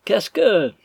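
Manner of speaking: high, loud mimicking question; mocking echo. A narrator's reading. Stranger repeats Arthur's question, loudly mocking. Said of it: "répéta l'inconnu à son tour".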